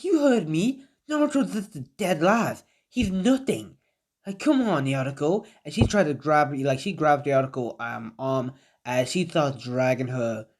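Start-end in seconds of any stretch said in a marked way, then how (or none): 0.0-5.4 high, sneering put-down; scornful Sakura. Acted. Sakura belittling Naruto to Yamato, high and sneering. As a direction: spit out the insult, pitched way up.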